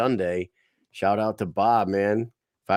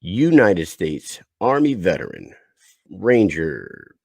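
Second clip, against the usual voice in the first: loud, measured announcer intro; 3 semitones lower, 5 dB louder, slower than usual. Real, not acted. Host introducing his veteran brother, loud and announcer-like. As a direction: introduce him like a fight announcer. Announcing the next guest's credentials.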